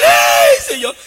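a sudden high, loud cry of mock grief; Samuel's lament. Samuel mourning Saúl before God, high mock wail. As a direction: wail it like a grieving prophet. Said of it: "Y Samuel".

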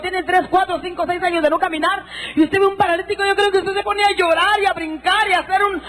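high, fervent preacher's testimony; impassioned preaching. Acted. Preacher retelling the healings to the congregation, fervent and high.

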